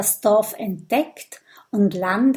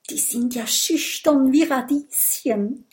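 usual speaking voice

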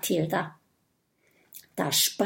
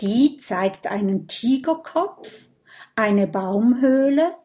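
plain reading voice

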